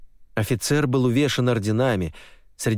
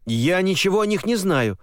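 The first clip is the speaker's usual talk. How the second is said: indignant defense; 10 semitones higher than usual. Acted. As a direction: protest your innocence heatedly. Officer defends himself.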